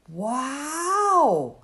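loud, high wow of amazement; Obed bursting out in amazement; amazed yell. Acted. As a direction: shout out in wonder. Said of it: "yelled Obed to Josiah".

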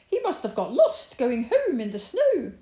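high, loud rabbit exclamation; excited cry. A narrator's reading. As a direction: cry out in concern for a lost friend. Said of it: "cried Rabbit".

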